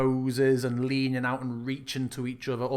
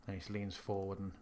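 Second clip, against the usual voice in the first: scene narration; 4 dB quieter than usual. Acted. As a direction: set the scene like a game master. GM describing the castle.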